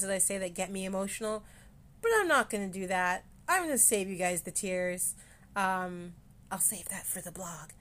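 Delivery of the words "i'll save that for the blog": joking aside. Real, not acted. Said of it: "I'm just joking".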